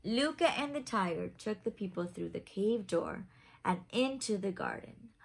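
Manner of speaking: lively picture-book narration; reading to children. A narrator's reading. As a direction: read it like a bedtime story for little ones. Reads picture book aloud.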